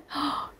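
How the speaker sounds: soft open-throated gasp; deep demonstrative inhale. Shown as a demonstration. Singer showing students the throat space, deep demonstrative inhale. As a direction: draw breath deep into the throat. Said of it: "깊이 쓰려고 하는 거죠".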